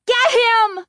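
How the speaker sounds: very high, loud yell; urgent shout. A narrator's reading. Chris shouting to her sister to chase the dummy, urgent shout. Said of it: "Chris shouted to her sister".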